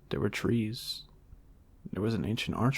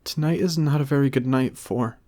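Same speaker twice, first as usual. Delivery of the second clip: higher, louder nervous plea; Maga pleading; 5 semitones higher, 7 dB louder than usual. A narrator's reading. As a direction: cut off mid-excuse.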